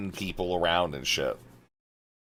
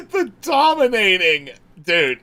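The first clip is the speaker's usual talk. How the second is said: high laughing exclamation; 10 dB louder than usual. Real, not acted. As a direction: blurt it out while laughing. Laughs at announcer line.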